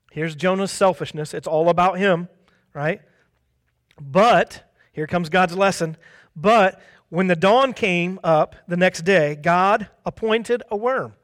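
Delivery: ordinary talk, nothing marked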